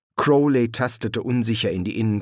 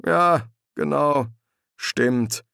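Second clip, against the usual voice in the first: slow, halting assent; downcast, drawn-out yes; dejected agreement; 5 semitones higher, much slower than usual. A narrator's reading. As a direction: agree slowly without conviction. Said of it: "Er wirkte niedergeschlagen und betrübt".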